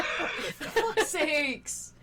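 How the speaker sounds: slow laughing groan of frustration; laughing exasperation. Real, not acted. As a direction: drag it out, laughing in despair. Bad roll, laughing frustration.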